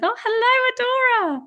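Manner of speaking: high, bright squeal of recognition; delighted surprise. Real, not acted. Greeting her old college friend, delighted high surprise.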